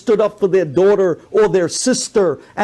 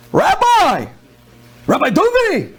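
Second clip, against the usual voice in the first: retold shout across street; 9 semitones higher than usual. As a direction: yell Rabbi Duvi to get his attention. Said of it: "he screams".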